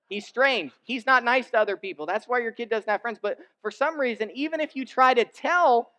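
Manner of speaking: loud, high-pitched preaching push; fervent preaching. Acted. Preacher scolds proud parents, loud and fervent. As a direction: drive the point home to the congregation. Preacher builds to full force.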